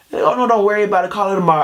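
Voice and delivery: reassuring parent. Retold or imitated.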